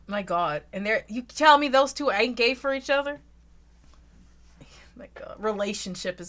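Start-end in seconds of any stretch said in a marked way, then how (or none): 4.9-5.3 breathless whispered my god. Real, not acted. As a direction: drop to a bare whisper. Whispered aside.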